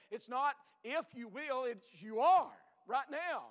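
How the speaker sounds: slow, loud preaching push; building preaching emphasis; emphatic. Acted. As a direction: drive the point home slowly and loud. Preacher presses point forcefully.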